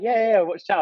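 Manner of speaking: a lightly voiced, high, excited runner; excited stranger. Runner at the Seville start pen hyping him up, lightly voiced high excitement. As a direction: toss it off like a hyped-up stranger.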